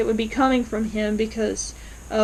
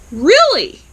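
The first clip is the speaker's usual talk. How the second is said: high, loud incredulous question; shocked; 7 semitones higher, 9 dB louder than usual. Retold or imitated. Viewer reacting to the DNA results, shocked. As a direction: blurt it in disbelief, retold. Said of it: "in shock".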